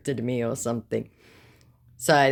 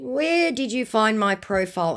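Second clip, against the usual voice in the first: higher, running questioning tone; challenging question; 9 semitones higher than usual. Retold or imitated. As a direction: quiz the suspicious stranger. First challenge to the scammer.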